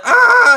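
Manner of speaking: very high, loud shriek; retold scream. Screaming while looking at the wife, terrified scream. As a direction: scream it high in acted terror. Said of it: "I was just start screaming".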